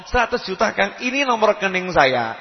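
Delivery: acted urgent request for help; pleading caller. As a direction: beg your friend on the phone. Acted phone plea for money.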